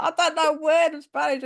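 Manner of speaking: laughing, mock-desperate protest; laughing disbelief. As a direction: protest through a laugh, half crying. Panicked reply to head teacher.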